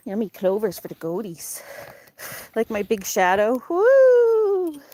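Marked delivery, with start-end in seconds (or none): 3.7-4.8 high, loud cheer; excited whoop. Real, not acted. Owner cheering her dog's digging, loud high whoop. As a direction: whoop for the dog.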